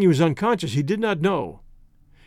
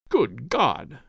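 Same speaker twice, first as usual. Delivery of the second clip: lower, hushed horror; horrified; 5 semitones lower than usual. A narrator's reading. Clayton realises the sailor means cannibalism, horrified. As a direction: let the dreadful realisation sink in. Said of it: "whispered Clayton in a horrified tone".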